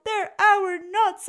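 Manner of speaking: high, loud outraged exclaim. Acted. Bruce exclaims at the bird, loud. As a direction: cry out in outrage. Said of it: "exclaimed Bruce".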